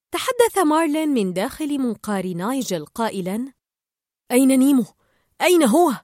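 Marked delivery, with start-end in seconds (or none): 4.3-5.9 loud, raised, anxious questions; urgent demand. A narrator's reading. Marlin from inside the pelican's beak, loud urgent questioning. Worried father demands his son.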